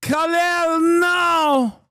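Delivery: put-on high shouted parody accent; shouted mock Middle Eastern accent. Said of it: "accent from the region".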